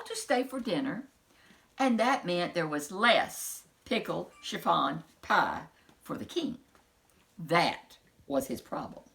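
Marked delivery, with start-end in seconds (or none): none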